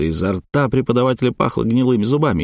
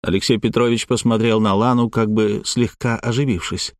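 plain reading voice

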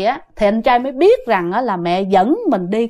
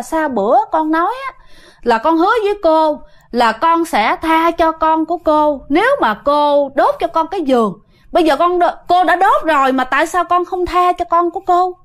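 retold mother confronting, pitched up; mild higher-pitched challenge; questioning reproach; 6 semitones higher than usual. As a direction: press for an answer, lightly acted. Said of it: "mẹ chất vấn như vậy".

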